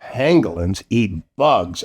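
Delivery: ordinary delivery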